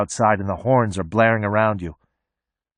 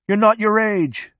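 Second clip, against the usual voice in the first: high, slow shout; 10 semitones higher, slower than usual. A narrator's reading. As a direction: chant it from the back seat. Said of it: "A space monkey shouts".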